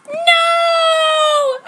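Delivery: piercing high shout; shrieked no; scream. Acted. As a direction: scream the word out in protest. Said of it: "I screamed".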